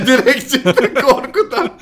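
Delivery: high laugh, slow words. Real, not acted. Laughing at a friend's exit.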